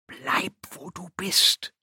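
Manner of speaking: slow, intense whisper; hushed, drawn-out warning; intense thought. A narrator's reading. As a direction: whisper a command inward, slowly. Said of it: "dachte sie intensiv".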